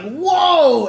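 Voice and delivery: loud, high startled whoa; surprised whoa. Retold or imitated. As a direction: gasp it out in surprise. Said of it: "And I'm like".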